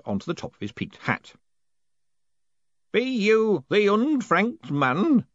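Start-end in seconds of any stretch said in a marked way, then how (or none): 2.9-5.2 put-on very slow, growling old man voice; growling old postman. A narrator's reading. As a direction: growl it out slowly. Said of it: "growled one of them".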